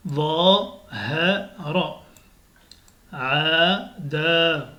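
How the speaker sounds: syllabes lues très lentement; slow reading. Shown as a demonstration. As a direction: lis chaque syllabe doucement. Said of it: "il faut vraiment lire doucement". (lecture lente et détachée)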